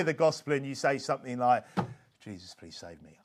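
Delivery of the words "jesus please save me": very soft, low murmured prayer; hushed, low plea; quiet mumble. Retold or imitated. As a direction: mumble it privately under your breath. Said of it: "I mumbled quietly".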